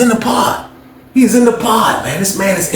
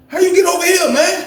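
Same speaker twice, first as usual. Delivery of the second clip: high surprised voice; 10 semitones higher than usual. Retold or imitated. Asking the man how he got over here, high voice. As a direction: pitch way up, incredulous. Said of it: "I was like".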